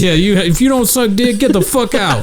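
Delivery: high, hammed-up bullying host; vicious host. Host kicking out the audience, high and mock vicious. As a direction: throw them out as a mock-aggressive comic.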